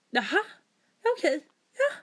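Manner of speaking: rising, exasperated, climbing high on "Vadå?"; frustrated disbelief. Retold or imitated. Telling her friend how the bank call felt, exasperated and incredulous. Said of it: "man blir frustrerad".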